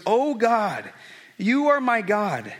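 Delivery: preacher reading Psalm 63 to the congregation, slow and earnest; scripture reading. Acted. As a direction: pray the psalm slowly from the heart.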